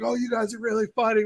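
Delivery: sarcastic retort. Retold or imitated. Acting annoyed players' retort.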